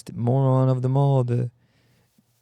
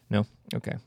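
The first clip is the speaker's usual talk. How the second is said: ordinary delivery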